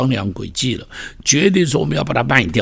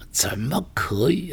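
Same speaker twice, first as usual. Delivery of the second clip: hushed aside; 8 dB quieter than usual. Pretend. Preacher voices the brothers' shock.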